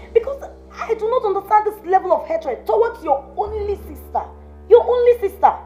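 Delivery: high, loud, furious scolding; shrill rage at the witch; outraged scolding. Acted. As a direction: hurl it at her in outrage. Outraged sister-in-law scolding.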